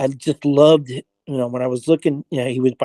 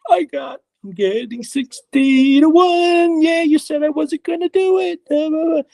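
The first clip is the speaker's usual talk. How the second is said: gloating taunt; 13 semitones higher than usual. Retold or imitated. Walking around the house taunting the doubters, mocking and gloating. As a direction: rub it in like a taunting kid.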